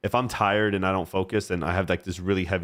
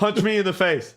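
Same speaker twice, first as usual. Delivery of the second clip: laughing; 11 semitones higher than usual. Retold or imitated. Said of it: "just opened the door and went".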